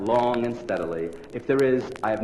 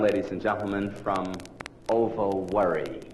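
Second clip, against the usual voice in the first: ordinary talk, nothing marked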